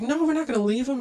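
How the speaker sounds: high, firm refusal; insistent. Retold or imitated. Girlfriend refusing to leave her man behind, high and insistent. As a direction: plant your feet and refuse.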